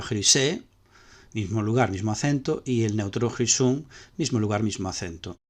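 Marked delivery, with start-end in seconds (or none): 0.0-0.6 "chrysé" higher, stressed on its last syllable SÉ; same final accent. Shown as a demonstration. Teacher showing the feminine keeps the accent, "chrysé" stressed on SÉ. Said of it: "lo conserva".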